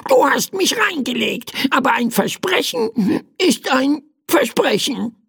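high, annoyed bird croak; verärgert krächzender Vogel. A narrator's reading. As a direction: grumble it in a crow's squawk. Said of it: "krächzte der Vogel verärgert". (verärgerte, krächzende Vogelstimme)

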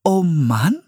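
high, rising puzzled echo; confused. A narrator's reading. Young woman echoing an odd phrase, confused. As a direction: repeat it as a bewildered question. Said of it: "wiederholte Priscilla verwirrt".

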